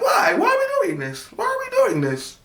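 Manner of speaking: very high shrill cry; yelling at mom in despair; shrieking plea. Retold or imitated. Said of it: "I was so like".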